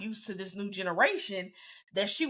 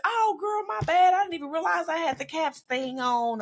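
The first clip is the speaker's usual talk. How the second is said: put-on breezy girlfriend apology; 8 semitones higher, 3 dB louder than usual. Friend apologizing over phone.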